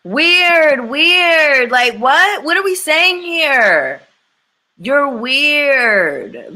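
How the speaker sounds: loud, high, drawn-out incredulous call; loud, exasperated disbelief; exclaimed disbelief. Real, not acted. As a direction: call out how weird this is, stretching it high and loud.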